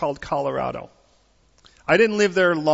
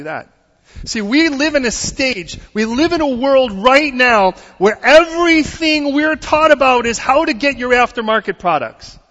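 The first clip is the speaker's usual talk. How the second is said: rising sermon voice, louder and higher; preaching; 7 semitones higher, 5 dB louder than usual. Acted. Pastor driving home the point to the congregation, fervent preaching. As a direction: preach it out with building force.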